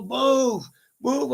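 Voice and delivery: high-pitched yell at a driver; impatient holler, acted out; urgent retold yell. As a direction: re-enact yelling at traffic, pitched high. Yelling at driver ahead, retold.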